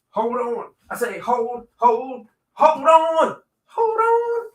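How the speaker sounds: playful sing-song, going up each time; rising sing-song. Pretend. Repeats hold on, pitch climbing.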